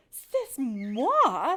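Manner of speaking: put-on loud indignant French accent; indignant French-accented mother mouse. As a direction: huff it in French.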